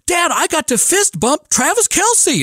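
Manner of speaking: thrilled son. Retold or imitated. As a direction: blurt it out like an overjoyed boy.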